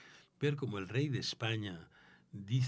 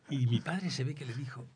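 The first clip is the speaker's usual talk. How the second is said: usual speaking voice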